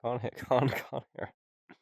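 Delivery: ordinary talk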